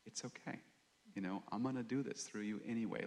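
the Lord voiced softly, hushed and kind; gentle reassurance. Preacher voicing the Lord comforting doubting Gideon, soft and kind. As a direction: soothe a frightened man, barely above a breath.

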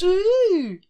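high, loud mocking ooh. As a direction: tease with a squeal. Mocking Broyles' smugness.

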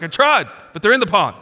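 mock exasperated protest; frustrated son. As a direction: imitate an exasperated teenager protesting, quick and loud. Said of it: "he was so frustrated".

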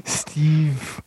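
"Steve" stretched out long in a put-on voice; the "right way" Steve. Shown as a demonstration. Podcast host demonstrating the name, "Steve" comically drawn out. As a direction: draw out the one word "Steve" in an exaggerated imitation. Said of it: "Say it the right way".

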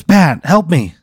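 loud, high plea for help; freaking out. Acted. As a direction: urgently beg a friend to help. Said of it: "Jim Gums starts freaking out".

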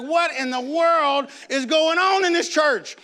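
high, drawn-out disbelief; mock bewilderment. Young churchgoer watches people run across pews, high slow mock bewilderment.

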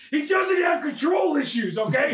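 high, slow, emphatic protest; mock defensive. Narrator defending Howard's tree habit, high indignant protest.

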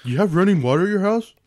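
high teasing question; mocking. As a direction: sneer like a bully. Mocking nerd stereotypes.

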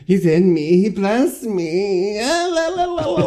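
high, slow, dreamy singsong of a madwoman; high eerie lilting chant; crazy possessed girl. Retold or imitated. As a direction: sing it out, lost in a holy trance. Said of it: "she's all, like, crazy".

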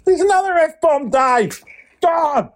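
high-pitched, exasperated telling-off; exasperated scolding. Real, not acted. Host catches Dave swearing, high exasperated scolding.

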